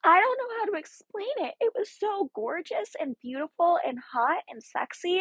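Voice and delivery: high-pitched, breathless fan gushing. Real, not acted. Fan describing a gorgeous romantic scene, gushing. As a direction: gush as if swooning over the romance.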